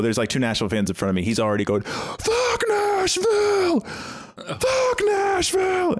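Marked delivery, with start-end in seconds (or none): 1.8-5.9 high, drawn-out heckler shout; imitated belligerent yelling. Retelling a belligerent fan at the game, imitated yelling. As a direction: stretch out the mock crowd yell. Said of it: "yelling, but not saying just".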